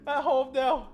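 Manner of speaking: talking through giggles, high; laughing. Real, not acted. Vlogger confessing her Jaffa Cake binge to viewers, laughing. Laughing about buying more boxes.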